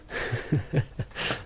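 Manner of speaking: soft, breathy chuckle; quiet laugh to himself, barely voiced. Real, not acted. Streamer amused at stirring the pot, soft chuckle. Chuckles after teasing someone.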